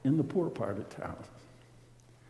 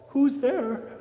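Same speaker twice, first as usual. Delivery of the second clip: woman calling out; 10 semitones higher, 5 dB louder than usual. Acted. Woman calling through door.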